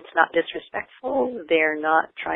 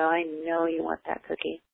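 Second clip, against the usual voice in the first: gentle calm firmness; 8 dB quieter than usual. Shown as a demonstration. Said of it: "you just go".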